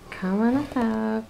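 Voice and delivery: slow coaxing. Real, not acted.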